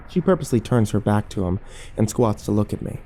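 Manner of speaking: ordinary delivery, nothing marked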